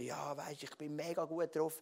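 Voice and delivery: softly acted stuffy-cold phone voice; mock head cold. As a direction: play down a head cold, quietly. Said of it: "dann merkst du richtig den Pfnüssel".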